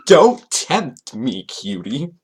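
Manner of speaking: milk the cheesy come-on, very slowly; exaggerated mock flirty. Host repeating the pickup line, hammed-up mock flirty.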